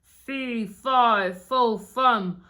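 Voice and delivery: loud, booming giant, slowing at the end; giant's voice. A narrator's reading. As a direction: menace the whole castle. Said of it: "said the giant".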